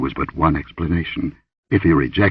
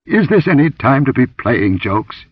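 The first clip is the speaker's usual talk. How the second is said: higher, irritated rebuke; acidly; 6 semitones higher, 3 dB louder than usual. A narrator's reading. Warrior scolds the woman for joking, acidly. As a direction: scold with sharp impatience. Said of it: "he demanded acidly".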